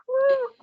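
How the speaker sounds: very high-pitched little cheer; excited woo. Real, not acted. Student so close to graduating, excited woo. Relieved cheer at nearing finish.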